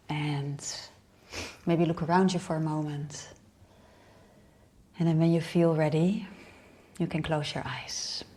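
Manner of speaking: unhurried meditation guide with long pauses; guided meditation. Real, not acted. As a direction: lead them inward in short, spaced-out phrases.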